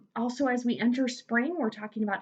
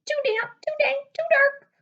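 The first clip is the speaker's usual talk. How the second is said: tiny mouse voice, much higher; squeaky mouse; 4 dB louder than usual. Acted. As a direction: squeak it out like a fussy little mouse. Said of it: "Mouse squeaks".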